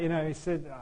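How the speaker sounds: ordinary delivery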